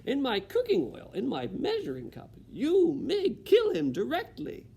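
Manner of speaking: high, loud outraged cook; rising fury at a mouse in the oil; Cook in outrage. Acted. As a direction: Cook ordering Mig to kill the mouse. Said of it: "said Cook".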